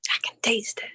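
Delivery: hushed, unvoiced echo; whispered. Shown as a demonstration. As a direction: whisper it breathlessly. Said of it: "echoes partner's line whispered".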